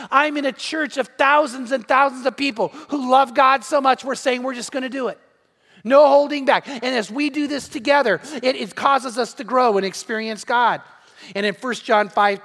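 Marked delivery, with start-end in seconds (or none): 5.8-6.7 preaching shout. Acted. Preacher's climax cry.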